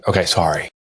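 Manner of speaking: low sheepish mock apology; meek sorry. Husband apologizing meekly to wife, low and sheepish. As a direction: mumble it like a scolded husband. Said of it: "I'm like".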